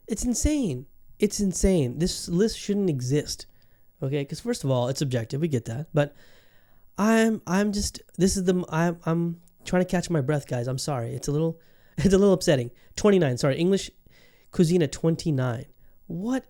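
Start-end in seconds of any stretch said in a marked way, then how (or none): none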